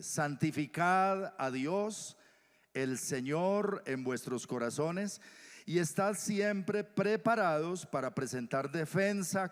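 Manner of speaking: slow, loud, emphatic recital of the verse; solemn scripture reading. Acted. Preacher declaims First Peter to the congregation, slow and loud. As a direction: proclaim each word slowly. Reads scripture slowly and forcefully.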